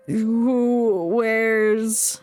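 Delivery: ordinary talk, nothing marked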